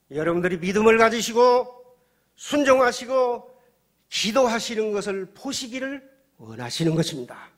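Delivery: high, booming words held long and set apart; full-force preaching. Real, not acted. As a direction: thunder the question at the congregation.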